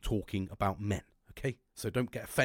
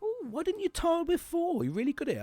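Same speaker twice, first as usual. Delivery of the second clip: much higher sing-song wife voice, impressed; imitating wife. Wife praising his tiling, high put-on voice. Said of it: "She goes".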